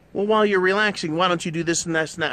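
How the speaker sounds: mock ex piling on chores; nagging ex. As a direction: rattle off chores like a nagging wife. Ex nagging, acted quarrel.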